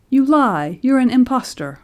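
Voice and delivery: loud, high furious shout; father shouting down an impostor; angry accusation. A narrator's reading. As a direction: hurl the accusation at him. Father accuses the old baby.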